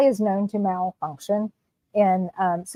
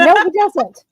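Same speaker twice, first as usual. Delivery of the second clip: playful loud denial; 8 semitones higher, 6 dB louder than usual. Real, not acted. Playful argument.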